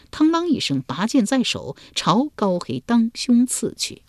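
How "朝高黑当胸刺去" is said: usual speaking voice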